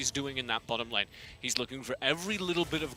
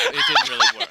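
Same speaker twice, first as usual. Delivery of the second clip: laughing; 10 dB louder than usual. Real, not acted. Laughing about the failed hiss.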